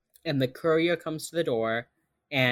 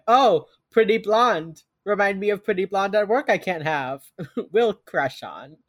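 higher, louder acted inner voice; mock lovestruck reaction; 5 semitones higher, 6 dB louder than usual. Host voices Reed spotting a pretty blonde, mock smitten.

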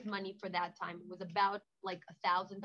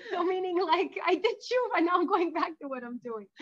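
laughing; 8 semitones higher, 12 dB louder than usual. Real, not acted. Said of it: "laugh heard".

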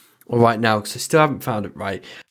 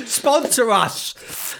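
mock plea; 9 semitones higher, much slower than usual. Begging sponsors for the podcast.

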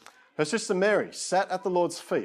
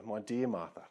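tender; 6 semitones lower, 9 dB quieter than usual. Acted. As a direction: lower and much softer, gentle and loving. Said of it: "You can almost hear the tone in his voice".

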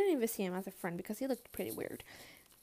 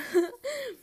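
awkward laugh; 13 semitones higher, 3 dB louder than usual. Retold or imitated. Embarrassed after teacher reveal.

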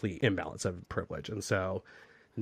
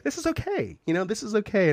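self-reassuring inner voice; 11 semitones higher than usual. Retold or imitated. Talking herself into it.

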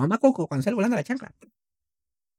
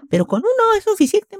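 much higher mock mother; mocking mom. Son imitating mom's Xbox reply, very high. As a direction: chirp the line in a mom voice. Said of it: "Así".

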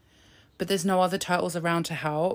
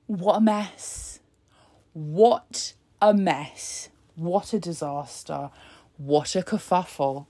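very slow, stretched What a mess; drawn-out mock lament; 3 semitones lower, slower than usual. Real, not acted. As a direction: savor the disaster slowly.

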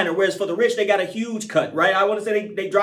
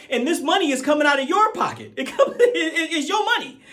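laughing through the words, pitch climbing high; 8 semitones higher than usual. Real, not acted. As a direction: break into real laughter mid-point. Laughs while making his point.

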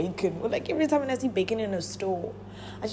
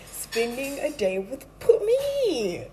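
announcing title; 6 semitones higher, 4 dB louder, slower than usual. Acted. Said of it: "I finally have a title".

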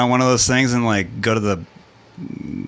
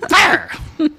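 shrieking Beavis yell, much higher; 3 dB louder than usual. Pretend. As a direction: screech it like Beavis. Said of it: "You just look like Beavis".

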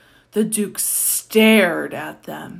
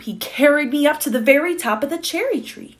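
ordinary delivery

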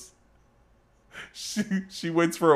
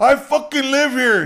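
indignant protest; 9 semitones higher, 6 dB louder than usual. Retold or imitated. Neighbor protesting to cop.

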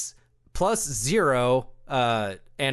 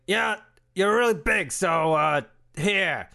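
higher offhand shopkeeper; Borkat voice; 4 semitones higher than usual. Acted. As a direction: shrug it off to the huge customer. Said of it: "He's like".

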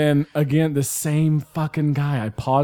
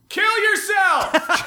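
heckler yell; much slower than usual. Retold or imitated. Heckler yelling at the comic.

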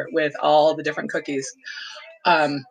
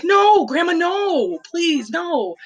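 put-on pleading child; 12 semitones higher, much slower than usual. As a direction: beg like a small child desperate to save cookies. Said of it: "And I was like".

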